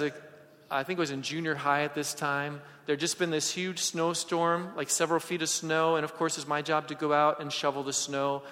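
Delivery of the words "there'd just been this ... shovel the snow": usual speaking voice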